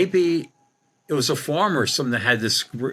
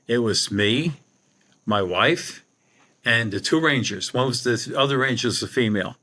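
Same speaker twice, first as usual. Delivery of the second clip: ordinary delivery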